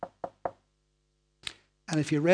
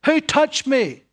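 loud, high shout; shouted question; 8 semitones higher, 8 dB louder, slower than usual. Acted. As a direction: shout it over the whole crowd. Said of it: "he probably shouted it".

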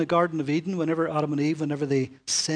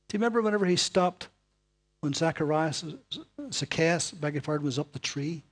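ordinary talk, nothing marked

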